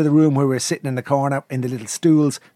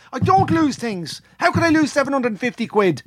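indignant insisting; 13 semitones higher than usual. Retold or imitated. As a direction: protest that he never loses things. Insisting angrily he never loses.

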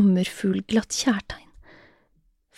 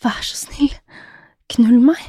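urgent plea; 3 semitones higher than usual. A narrator's reading. Jenny begging Bjørn, urgently pleading. Woman begs her lover.

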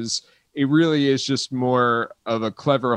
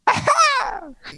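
with a quick kiss. Pretend. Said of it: "and go".

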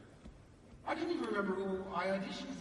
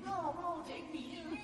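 put-on much higher, pleading little-kid whine; whiny kid begging; 13 semitones higher than usual. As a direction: beg like a child stamping his feet. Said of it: "stamping my feet".

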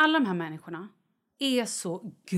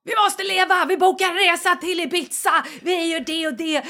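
frantic, higher, louder pleading; excited; 6 semitones higher, 4 dB louder than usual. Retold or imitated. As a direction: urge him to live, breathless. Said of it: "jag bara så här". (uppjagat till Kalle, högre och ivrigare)

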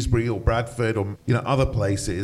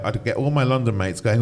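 ordinary delivery

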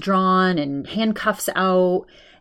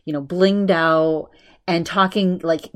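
normal talking voice

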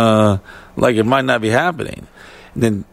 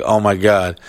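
ordinary talk

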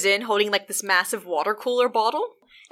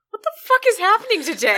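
much higher, louder, laughing; panicked boss; 11 semitones higher, 4 dB louder than usual. Retold or imitated. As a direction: squeal it in comic panic.

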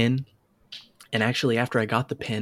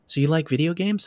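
curious coworker; 5 semitones higher than usual. Retold or imitated. Coworker asks about video games.

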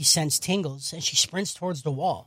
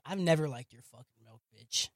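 low quiet sneer; 6 semitones lower, 9 dB quieter than usual. Retold or imitated. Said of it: "She's like".

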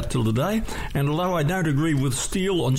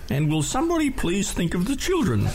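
a mildly put-on fussy letter writer; Green Guide Letters voice; 4 semitones higher than usual. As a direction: plead in a lightly voiced complainer. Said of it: "in the Green Guide Letters voice".

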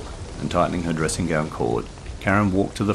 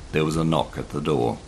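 ordinary delivery, nothing marked

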